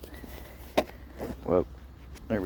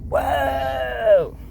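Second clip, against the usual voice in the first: high startled yelp; startled shout; 4 dB louder than usual. Retold or imitated. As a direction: jump back with a loud whoa. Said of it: "And I was like".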